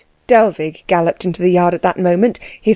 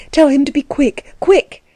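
urgent; 7 semitones higher than usual. A narrator's reading. Urgent orders for the carriage.

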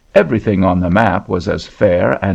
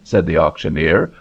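ordinary delivery, nothing marked

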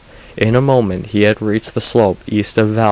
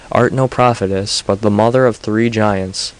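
ordinary delivery

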